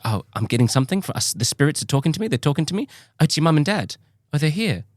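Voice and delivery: high imitated Australian accent, building to mock protest; Australian psychic. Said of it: "like, an Australian psychic".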